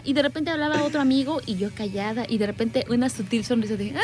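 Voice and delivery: normal talking voice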